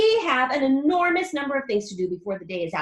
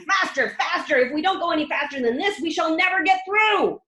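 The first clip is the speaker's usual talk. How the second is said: louder, urgent; eager urging; 5 semitones higher, 6 dB louder than usual. A narrator's reading. Wonka urging the lift faster, louder. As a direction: drive it on. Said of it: "cried Mister Wonka".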